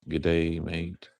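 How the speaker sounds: low, soft Australian greeting; quiet Australian-accented quote. Retold or imitated. Reading out an Australian viewer's words, Australian accent. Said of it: "a typical Australian accent".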